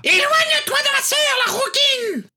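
a fierce big sister screaming a threat; roaring shout. A narrator's reading. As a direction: bellow it at her to back off from your sister. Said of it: "venait de rugir sur Molly Prewett".

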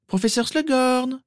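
very high-pitched eager call. Acted. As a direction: hail the professor in a girl's high voice. Girl hails a professor. (appel aigu et empressé)

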